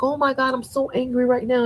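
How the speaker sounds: high, mock-outraged voice; mocking angry followers. Mocking the angry followers to her viewers, in a high mock-outraged voice. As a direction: parody their fake outrage. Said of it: "they're over there like".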